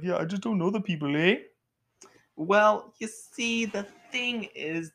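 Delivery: put-on imagined viewer in a mild Canadian accent. Imagined viewer doubting humor, in a light Canadian accent. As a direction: say it like a laid-back Canadian, eh. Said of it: "that person sounded Canadian".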